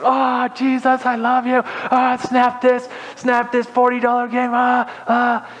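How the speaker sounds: high, held strained cry; hammed-up high-pitched anguish; mock straining zeal. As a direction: act out snapping things with overwrought effort. Acts out snapping his games in half.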